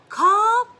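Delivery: put-on very high, loud toddler voice with an accent; imitating my daughter on a pretend phone; toddler saying call. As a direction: squeal call like a tiny toddler. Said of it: "she'll go".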